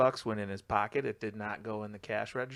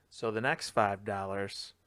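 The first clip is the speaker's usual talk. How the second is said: ordinary delivery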